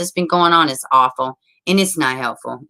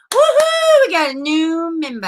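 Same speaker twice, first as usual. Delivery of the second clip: high, loud woohoo for the newcomer; excited cheer; 11 semitones higher, 4 dB louder than usual. Real, not acted. Streamer cheering a new member joining, high excited whoop. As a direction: whoop like you just got great news.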